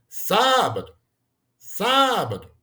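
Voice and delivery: "sábado" called out, long high SÁ; called-out word, first syllable stressed. Shown as a demonstration. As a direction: call "sábado" as if hailing someone, stretching SÁ. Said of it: "A gente chama assim".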